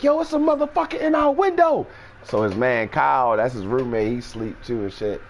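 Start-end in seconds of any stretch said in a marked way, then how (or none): 0.0-1.9 very high alarm; alarmed Lance. Retold or imitated. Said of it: "my man Lance wake up like".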